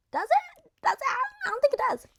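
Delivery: high, loud mock girl voice, dismissive; mock Shelly. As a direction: toss off his advances in a high voice. Voicing Shelly turning him down.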